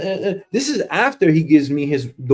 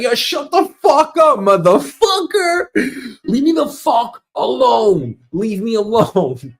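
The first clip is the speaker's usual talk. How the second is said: furious shout; 7 semitones higher than usual. Acted. Sick of the office small talk, a furious high shout. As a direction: scream at them to leave him alone. Exploding at chatty coworkers.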